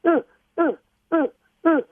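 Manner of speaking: short, high-pitched yelps like a dog; high doggy yips, one at a time; imitated dog yelping. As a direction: imitate a frightened dog with four spaced, high little yelps. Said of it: "was yelping like".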